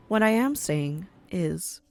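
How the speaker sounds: ordinary talk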